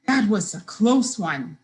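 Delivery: plain reading voice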